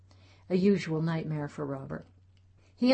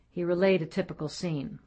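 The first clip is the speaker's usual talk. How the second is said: usual speaking voice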